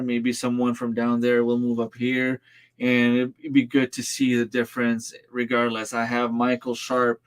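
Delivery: ordinary talk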